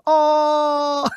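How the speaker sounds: high, drawn-out mock yell; LeBron James scream. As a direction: hold a cartoonish yell on one note. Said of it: "reminds me of the LeBron James Space Jam".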